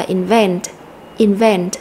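invent with the second syllable VENT stressed; in-VENT, last syllable punched. Shown as a demonstration. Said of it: "âm tiết số hai".

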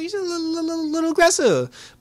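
high, squeaky mock-nervous aside; high timid voice. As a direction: pitch it way up, like a nervous joke. Own wary aside retold.

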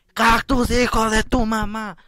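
playful outburst at an enemy; mock angry shout. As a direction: bellow the insult in hammed-up rage. Curses the cactus.

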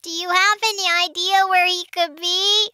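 ordinary delivery

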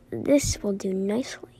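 menacing whisper; sinister whisper. Acted. Villain scheming.

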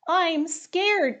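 a frightened little cub; timid and high, a touch fearful; scared. Acted. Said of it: "Panda said".

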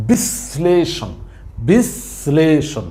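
slow বিশ্লেষণ as বিশ-শ্লেষণ; বিশ্লেষণ drawn out with শ heard twice; doubled শ. Shown as a demonstration. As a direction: hold the first শ of বিশ্লেষণ, then release শ্লেষণ. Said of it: "উচ্চারণের ক্ষেত্রে আমরা একটু ভালো করে".

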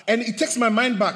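ordinary talk

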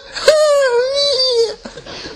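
high mock girlish giggle; giggly squeal laugh. Preacher mocking girls swooning over a star, high giggle. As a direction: giggle like a smitten fan.

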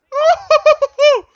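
high burst of delighted laughter; giddy laugh. Real, not acted. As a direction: laugh out loud, thrilled. Laughs after the goal.

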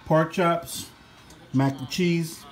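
usual speaking voice

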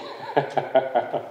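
soft airy chuckle at his own joke; a short quiet laugh; breathy laugh. Real, not acted. Laughs after own impression.

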